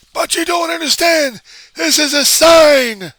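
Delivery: high, very loud freakout; conniption fit. Acted. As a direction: scream it hysterically, stretching the end. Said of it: "a guy having a conniption fit and freaking out".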